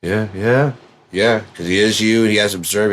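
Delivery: ordinary delivery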